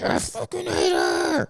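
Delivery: strained, drawn-out pretend rage; mock scream forced through a tight throat. Kid silently cursing mom in his head, strained mock scream.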